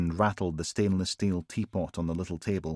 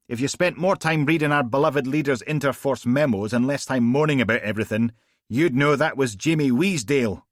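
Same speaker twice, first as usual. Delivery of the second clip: higher, louder telling-off; Steele scolding; 6 semitones higher, 5 dB louder than usual. Acted. Steele scolding Logan for not reading memos, louder. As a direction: put him in his place.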